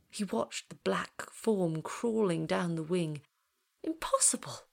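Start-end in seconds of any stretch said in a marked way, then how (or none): 3.8-4.6 high, airy disbelief; shocked. A narrator's reading. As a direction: gasp it out in stunned disbelief. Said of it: "his voice declared itself".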